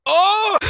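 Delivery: very high, loud "oh" of revulsion; loud disgusted cry. Real, not acted. Disgust after hearing the clip.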